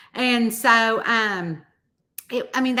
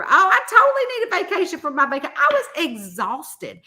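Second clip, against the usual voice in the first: excited outburst; 8 semitones higher, 5 dB louder than usual. Real, not acted. Exclaims about tiring vacation.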